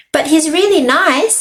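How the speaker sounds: high-pitched, mild protest; light storybook objection in a high voice; arguing. Acted. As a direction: argue back, pitched well up.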